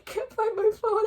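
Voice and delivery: high-pitched flustered whine; imitated helpless fluster; mock panic. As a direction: fret like you're frantically rummaging. Flustered phone search acted out.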